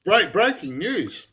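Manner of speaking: mock newsreader. Host opening a mock news segment, in a slow newsreader voice. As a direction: announce it like a breaking bulletin.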